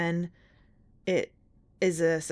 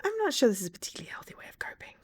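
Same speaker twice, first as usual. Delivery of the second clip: fast, quick unvoiced aside; whisper; 6 semitones higher, much faster than usual. Real, not acted. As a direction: mutter it under your breath.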